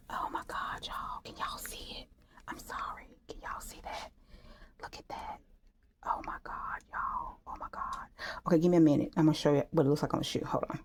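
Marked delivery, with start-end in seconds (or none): 0.1-8.1 hushed, voiceless amazement; barely audible, overcome whisper; awed whisper. Real, not acted. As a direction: whisper it to the viewers in disbelief. Overcome, showing viewers the result.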